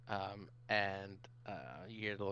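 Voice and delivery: normal talking voice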